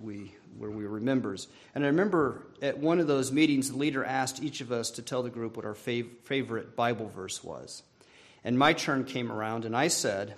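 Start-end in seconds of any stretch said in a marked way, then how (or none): none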